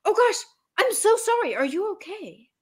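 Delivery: high apologetic voice; mock apology. Driver apologizes to the honking driver, lightly acted. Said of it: "the person who made the bad thing goes".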